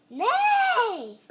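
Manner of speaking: high, loud whinny; whinny like a horse; a high neigh. Acted. Child imitating a horse.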